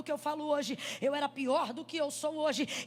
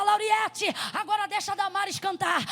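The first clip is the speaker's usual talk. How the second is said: calling out the next singer, louder, half in play; loud mock announcing; 4 semitones higher, 7 dB louder than usual. Child playing preacher introducing the next CD, loud call. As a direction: announce it like a pretend pastor. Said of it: "Aí eu dizia".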